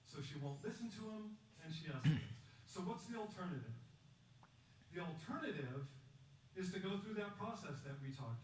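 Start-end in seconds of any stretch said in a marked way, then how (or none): 1.6-2.4 and she escalates, much louder, voice swelling up; louder escalation. Shown as a demonstration. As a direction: let escalates burst out loud, acting the escalation. Repeats line, then escalates louder.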